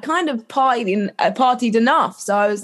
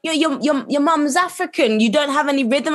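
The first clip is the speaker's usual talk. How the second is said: higher, teasing voice; mocking other people; 7 semitones higher than usual. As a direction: mimic the doubters lightly. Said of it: "people are like".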